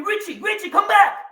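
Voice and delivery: high mock yell after him; teacher yelling. Teacher Eisenberg chasing him out, high and yelling. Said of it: "starts screaming".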